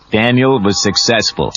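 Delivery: plain reading voice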